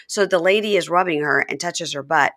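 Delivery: ordinary delivery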